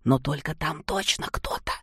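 unvoiced fearful whisper; frightened whisper. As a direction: insist in a scared whisper. Seryoga insists in fear.

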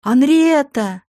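slow drawn-out call from far off; distant call. A narrator's reading. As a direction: call her name across the water. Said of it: "Голос в отдалении крикнул".

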